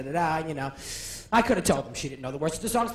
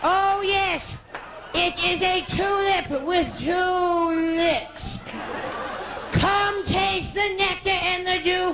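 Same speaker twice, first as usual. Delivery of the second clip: very slow, higher, slurred grand line; hammed-up slurred Bette Davis; Bette Davis after a stroke; 4 semitones higher, much slower than usual. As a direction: drag each word like a stricken diva. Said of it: "like Betty Davis after the stroke".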